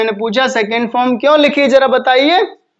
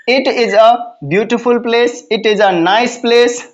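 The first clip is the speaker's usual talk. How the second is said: slow, word by word, "it is a beautiful place"; slow dictation; much slower than usual. Shown as a demonstration. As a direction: spell out every word slowly like dictation. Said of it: "ऐसा आप यहाँ पर use कर सकते हैं". ("it is a beautiful place" धीरे-धीरे, हर शब्द अलग बोलते हुए)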